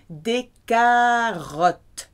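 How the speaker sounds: Des carottes said slowly, French R and stress on the end. Shown as a demonstration. Teacher demonstrates carrots in French, slowly. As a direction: draw out Des carottes with a clear French accent. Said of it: "Just say it with a French accent".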